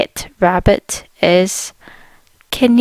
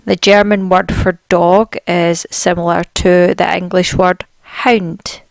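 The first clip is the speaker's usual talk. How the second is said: usual speaking voice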